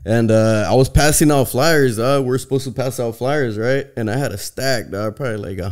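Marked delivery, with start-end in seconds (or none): none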